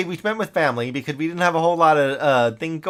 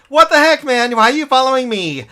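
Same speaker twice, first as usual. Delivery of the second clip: startled yell; 8 semitones higher, 8 dB louder than usual. Real, not acted. Startled by a mob in game.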